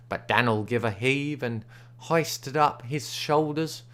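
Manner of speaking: plain reading voice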